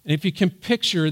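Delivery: ordinary talk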